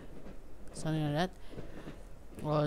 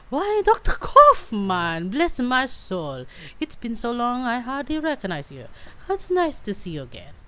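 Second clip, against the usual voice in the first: put-on surprised old housekeeper; 6 semitones higher, 5 dB louder than usual. Housekeeper recognizing the doctor at the door, delighted. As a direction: greet him like a startled, delighted old maid.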